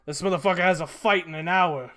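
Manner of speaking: put-on loud indignant old goblin; gravelly sly hobgoblin. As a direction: protest like a pushy old goblin. Hobgoblin protests loudly.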